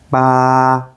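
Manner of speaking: "Ba" held flat and steady; long level "Ba", like singing a note; first tone. Shown as a demonstration. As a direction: sustain "Ba" on one even note. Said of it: "keep your voice high and even".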